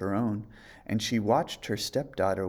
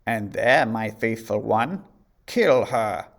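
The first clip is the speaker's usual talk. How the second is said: queen's order; 6 dB louder than usual. A narrator's reading. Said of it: "she said".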